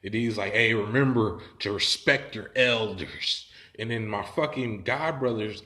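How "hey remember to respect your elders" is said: slow, loud lecturing voice; mock admonishing elder. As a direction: draw it out like a pompous reminder. Stranger admonishes the group.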